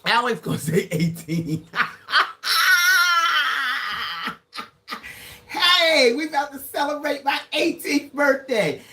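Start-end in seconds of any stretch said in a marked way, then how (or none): 1.7-4.3 a high, delighted squeal of laughter; high laughing squeal. Real, not acted. Squealing laugh at a viewer's age guess. 5.5-8.8 excited call. Real, not acted. Calling out to the viewers.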